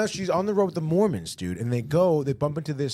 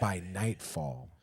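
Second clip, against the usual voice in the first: menacing whisper; 7 semitones lower, 11 dB quieter, slower than usual. Retold or imitated. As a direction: drop the voice and slowly hiss the warning.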